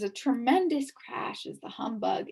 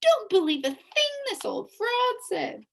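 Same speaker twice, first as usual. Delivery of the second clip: bee's warning; 12 semitones higher, 6 dB louder than usual. A narrator's reading. Bee warning Milo.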